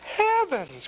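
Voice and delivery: high-pitched storybook exclamation of dismay; crying out. A narrator's reading. Farmer's wife finding the pig pen, crying out. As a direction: cry out high in a housewife's voice. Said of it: "cries the farmer's wife".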